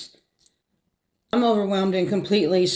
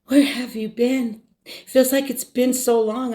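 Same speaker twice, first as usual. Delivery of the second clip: sweet little voice; 7 semitones higher than usual. Retold or imitated. Mom asks daughter after a week apart, sweet little voice. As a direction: imitate mom's sweet voice, pitched up. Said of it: "with her sweet little voice".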